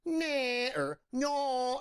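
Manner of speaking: high cat cry; cat sound demo. Shown as a demonstration. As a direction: imitate a cat in high squeaks. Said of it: "it's like, it's like".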